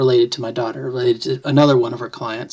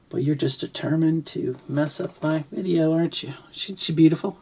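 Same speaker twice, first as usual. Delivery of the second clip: higher, softer cooing to a pet; fond pet talk; 3 semitones higher, 6 dB quieter than usual. Real, not acted. Owner to cat spoiling the video, soft fond coo. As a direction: coo gently at the animal.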